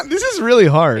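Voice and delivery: high loud laughing voice; can't stop laughing. Real, not acted. Cracking up during contest.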